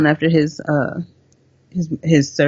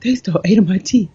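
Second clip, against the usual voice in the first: high son; 3 semitones higher than usual. Retold or imitated. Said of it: "He was so high".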